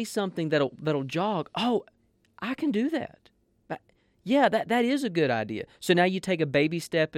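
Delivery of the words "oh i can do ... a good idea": excited realization. Retold or imitated. Imagined eager churchgoer response.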